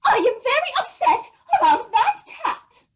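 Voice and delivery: upset mother. Acted. Mother complaining about the cat, hammed-up and cross. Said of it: "but Elmer's mother said".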